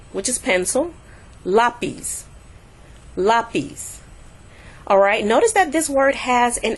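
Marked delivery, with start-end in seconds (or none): 1.5-4.0 "Lápiz" with LÁ stressed, "piz" falling away; stress on first syllable. Shown as a demonstration. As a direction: lean on the "lá" of "Lápiz". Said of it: "go with the next to the last syllable".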